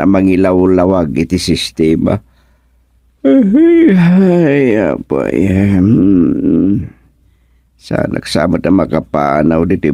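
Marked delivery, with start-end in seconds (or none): 3.2-6.8 pained groans. Acted. Old man groaning in pain.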